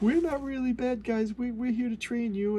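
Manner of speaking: mocking a fake-friendly pitch; mock innocent voice. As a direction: play it falsely sweet. Mocking the heroes' excuse.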